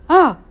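startled grunt of disgust; kid's startled ugh at a scary sight. Retold or imitated. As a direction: recoil with a scared ugh. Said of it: "I kinda went".

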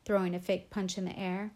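ordinary delivery, nothing marked

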